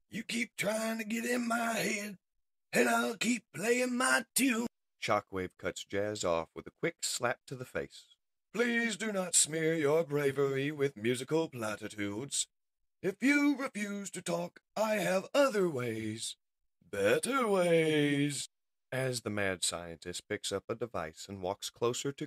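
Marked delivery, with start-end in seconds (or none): 0.1-4.7 high-pitched cocky defiance; defiant captive. Acted. Captive Jazz taunts his interrogator, defiant. 8.5-18.5 higher, theatrical villain threat; menacing mad scientist. Acted. Mad scientist threatens captives who refuse to talk, menacing.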